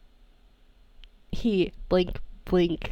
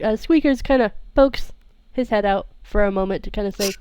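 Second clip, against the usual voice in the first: ordinary talk, nothing marked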